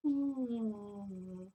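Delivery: long, drawn-out hum sliding gently down; slow "mm" sound. Retold or imitated. Teller shows how slowly they unbuttoned, slow soft hum. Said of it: "like, really slow, like".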